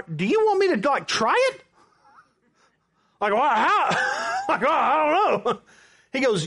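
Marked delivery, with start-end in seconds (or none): 0.0-1.6 high, hesitant disbelieving question; baffled and high-pitched; incredulous question. Retold or imitated. Retold reaction to pressure to try. 3.3-5.6 high, whiny mock bewilderment; hammed-up flustered squeal. Mock panic at being asked.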